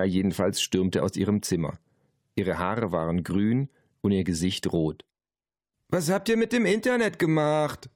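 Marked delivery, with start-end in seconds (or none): 5.9-7.9 high-pitched angry demand; furious. A narrator's reading. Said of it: "fragte sie wütend". (wütend, mit hoher Stimme)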